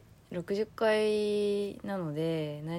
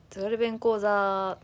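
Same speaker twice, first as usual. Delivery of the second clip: segment announcement; 2 semitones higher, 7 dB louder, slower than usual. Acted.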